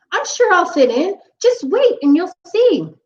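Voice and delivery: hopeful pumpkin. Acted. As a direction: reassure the witch brightly.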